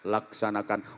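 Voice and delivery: low, soft easy command; relaxed reply. Shown as a demonstration. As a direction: answer calmly, no fuss. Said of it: "nyantai jawabnya".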